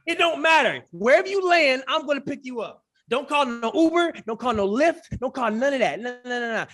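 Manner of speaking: high, forceful overriding promise; playfully insistent. Pretend. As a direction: brush aside every objection with hype. Overrides the host's protest insistently.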